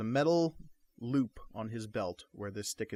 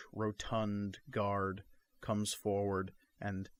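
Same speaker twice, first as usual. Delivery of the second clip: ordinary talk, nothing marked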